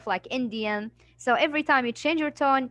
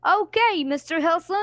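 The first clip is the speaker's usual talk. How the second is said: put-on high eager boy voice, louder; 5 semitones higher, 3 dB louder than usual. Shown as a demonstration. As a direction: answer like a keen young boy. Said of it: "change your tone to be a boy".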